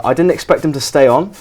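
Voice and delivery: ordinary delivery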